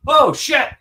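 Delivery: sudden high alarmed cry; shocked exclaim. Retold or imitated. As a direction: yelp it in shock. Retelling Charn's shocked reaction.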